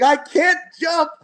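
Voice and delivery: high, slow mock wail of a scared kid. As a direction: stretch it out like a terrified child. Scared daughter refuses to jump.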